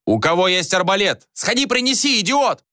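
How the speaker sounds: high-pitched impatient shouting; shouts from below. A narrator's reading. Soldiers below demand a crossbow, impatient high shouts. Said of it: "Снизу раздавались крики".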